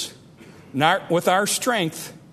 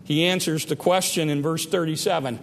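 normal talking voice

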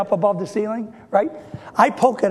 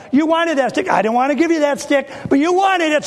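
higher, louder indignant protest; 6 semitones higher, 4 dB louder than usual. Acted. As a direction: argue back, getting worked up. Moses arguing back with God.

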